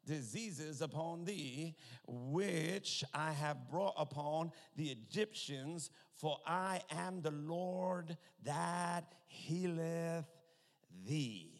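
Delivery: very slow, stretched-out declaiming; weighty, deliberate pulpit reading; solemn scripture reading. Real, not acted. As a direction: draw out each word of the verse, dropping on the last. Reads scripture aloud.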